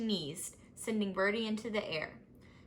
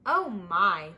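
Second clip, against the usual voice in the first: oh my; 7 dB louder than usual. Acted. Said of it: "they chorused".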